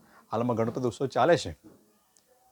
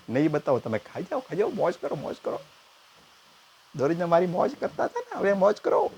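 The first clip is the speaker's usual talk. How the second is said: higher, mocking little boy; taunting kid; 6 semitones higher than usual. Nephew gloating at the uncle, higher mocking kid. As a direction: rub it in like a smug child.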